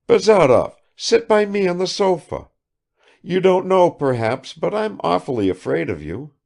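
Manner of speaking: high, loud, flirtatious lady; coquettish Kukshina. A narrator's reading. Kukshina invites Bazarov to sit by her, high and flirty. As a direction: coax him onto the sofa.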